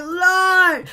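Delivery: loud, high cry of astonishment; amazed exclamation. Retold or imitated. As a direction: call out in mock-prayerful amazement. Retells amazed reaction.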